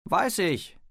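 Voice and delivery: kurzer, hoher, lauter Zuruf; Zurückrufen. A narrator's reading. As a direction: im Weggehen zurückrufen. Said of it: "hatte er zurückgerufen". (laut und hoch zurückrufend)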